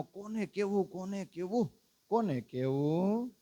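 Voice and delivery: hushed, slowing, a little lost; soft repeated question. Acted.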